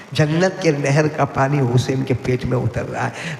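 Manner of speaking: slow, deliberate جنت کے نہر, every word spaced. Shown as a demonstration. Preacher repeating the Prophet's words to the crowd, slow. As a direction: stretch the line out slowly for weight. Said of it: "repeated line".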